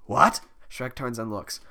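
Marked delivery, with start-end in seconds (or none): 0.0-0.4 startled. Acted. Shrek startled.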